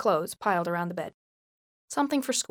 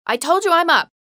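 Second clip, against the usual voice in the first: high, loud shout; 8 semitones higher, 7 dB louder than usual. A narrator's reading. Girl shouts at her mother through the door, shout. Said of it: "Justine shouted through the door".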